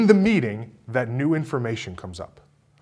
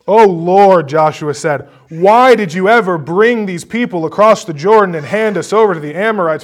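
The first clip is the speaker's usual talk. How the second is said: higher, louder complaining cry to the Lord; whiny Joshua; 7 semitones higher, 14 dB louder than usual. Retold or imitated. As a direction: wail it like a whining leader. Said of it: "that's how I hear that in my head".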